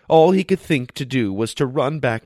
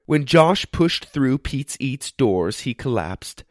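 plain reading voice

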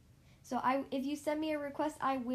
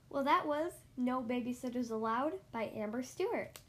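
ordinary delivery, nothing marked

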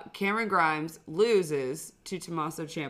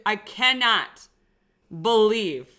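upset disbelief; 3 semitones higher, 7 dB louder than usual. Real, not acted. Podcaster ranting to co-host about a buried wrestler, upset and loud. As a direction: hammer out each phrase with heavy pauses. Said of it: "I'm so upset right now".

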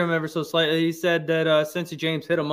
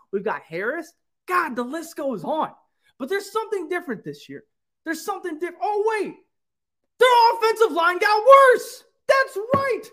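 hype speech; 9 semitones higher than usual. Acted. Host firing up Bengals fans like a war leader.

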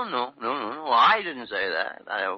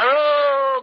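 calling out; 11 semitones higher, 10 dB louder than usual. Acted. Calling out to Dr. Carew.

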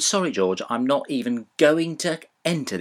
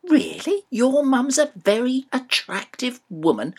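high disbelieving question; shocked echo of the compliment; incredulous; 9 semitones higher than usual. Pretend. Dad shocked repeats the compliment.

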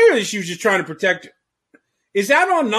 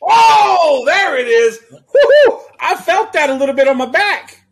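loud excited yelp of delight; excited shout; 8 semitones higher, 9 dB louder than usual. Real, not acted. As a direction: whoop at the whip crack. Reacting to whip sound.

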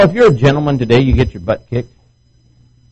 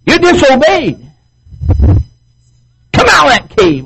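high, loud, slow booming divine rebuke; hammed-up angry God calling into the cave; God scolding; 14 semitones higher, 6 dB louder, much slower than usual. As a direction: thunder down at the runaway prophet. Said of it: "the Bible says".

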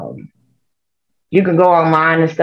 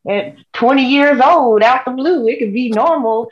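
higher, hammed-up fake expert; mock the paid doctor's made-up excuse, voice raised; lying doctor; 7 semitones higher than usual. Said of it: "have a doctor come out and lie and say".

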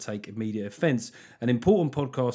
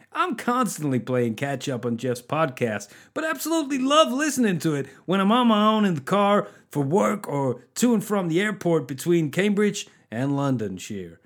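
higher-pitched, hammed-up American accent; 5 semitones higher than usual. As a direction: read it out as a caricature American. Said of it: "I'm just stereotyping the Americans".

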